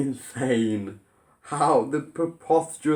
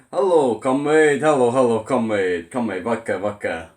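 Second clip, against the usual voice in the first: hammed-up heavy Russian accent, louder, sinking lower; mock Russian accent; 5 semitones lower, 4 dB louder than usual. Russian spies greeting comrades, in a mock heavy Russian accent. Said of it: "not to have a heavy Russian accent".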